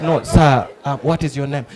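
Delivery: low, restrained interviewer voice; patient reporter. Retold or imitated. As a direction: politely rein in a ranting interviewee. Reporter calms the ranting witness.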